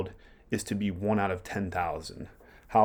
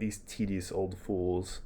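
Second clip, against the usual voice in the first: slow, quieter contemptuous aside; scornful aside; 6 dB quieter, slower than usual. Acted. Hamlet sneering at the departing old courtier, soft slow scorn. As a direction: let it drip with slow disdain under your breath. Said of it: "referencing Polonius there".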